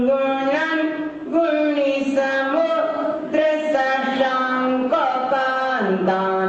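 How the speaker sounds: drawn-out Sanskrit recitation, word by word; slow verse recitation. Shown as a demonstration. Teacher recites the verse slowly for the class. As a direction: chant each word slowly like a shloka.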